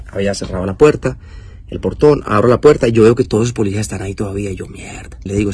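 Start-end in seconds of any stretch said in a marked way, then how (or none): 4.7-5.2 breathless whispered swear under his breath; whispered curse. Retold or imitated. Seeing the police still outside, he curses in a whisper. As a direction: whisper it so the cops can't hear.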